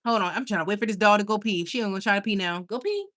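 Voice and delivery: normal talking voice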